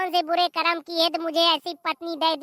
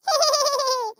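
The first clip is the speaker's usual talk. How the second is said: put-on child's giggle; 9 semitones higher than usual. Child character laughs mischievously.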